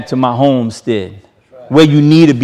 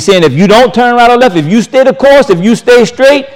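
rising exhortation; 8 semitones higher than usual. Real, not acted. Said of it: "building exhortation".

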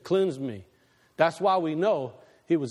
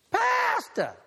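startled call. Retold or imitated. Congregant calls out to the pastor.

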